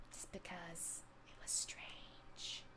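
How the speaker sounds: a hushed whisper of a secret. Real, not acted. Confiding to chat about the strange orb, whispered. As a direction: breathe it out like a confession. Ends spooky story, voice drops.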